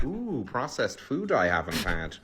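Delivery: lightly put-on American southern accent; mock southern American accent. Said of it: "I used a southern accent there".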